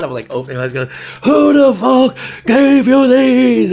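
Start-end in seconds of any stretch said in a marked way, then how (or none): 1.2-3.7 even higher hammed-up scream, very loud; furious girlfriend. Girlfriend shaking the bed over underwear, screaming furiously. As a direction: repeat the mock yell at full pitch, stretched. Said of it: "She was really angry".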